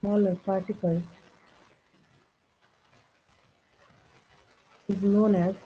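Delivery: very slow, word-by-word dictation; low-key, measured dictation with long gaps. Real, not acted. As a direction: read it out slowly for note-takers. Dictates notes while writing.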